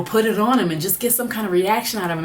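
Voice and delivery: usual speaking voice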